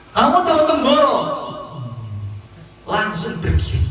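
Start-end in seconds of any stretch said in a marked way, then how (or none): 0.1-1.2 high, excited question to the patient; rousing question. Acted. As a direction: quiz him in a raised pitch. Tabib bertanya membangkitkan pasien.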